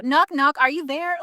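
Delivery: ordinary talk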